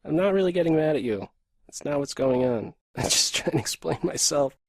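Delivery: usual speaking voice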